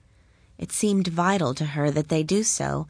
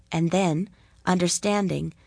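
ordinary delivery, nothing marked

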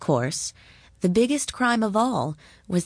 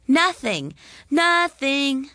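high, loud jeering chant; 8 semitones higher, 4 dB louder, slower than usual. A narrator's reading. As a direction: taunt her together. Children laugh and jeer.

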